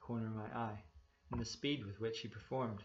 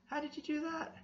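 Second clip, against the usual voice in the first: much higher, squeaking disbelief; amazed question. A narrator's reading. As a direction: ask in wide-eyed astonishment. Narrator amazed at the trick.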